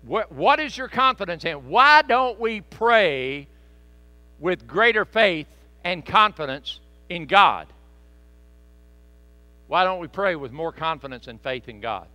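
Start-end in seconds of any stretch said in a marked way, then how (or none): 1.7-7.7 very slow, loud, drawn-out preaching; slow emphatic question. Real, not acted. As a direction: stretch every word to press it home.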